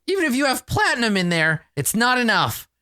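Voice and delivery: quick, high-pitched disdain; scornful dismissal. Acted. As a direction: turn them down in a high, scornful voice. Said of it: "sneers and Lala looks at the bag and she says".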